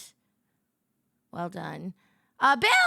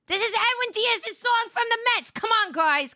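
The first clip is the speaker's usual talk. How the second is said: very excited; 10 semitones higher than usual. Real, not acted. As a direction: burst out like a thrilled Mets fan. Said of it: "I got very excited".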